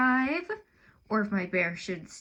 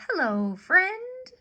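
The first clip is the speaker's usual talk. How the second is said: higher, slower friendly bear voice; voicing the bear; 11 semitones higher, 5 dB louder, slower than usual. Bear greets his friend the bird, higher slow friendly voice. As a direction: greet the new friend brightly and slowly, up high. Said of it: "and the bear is saying".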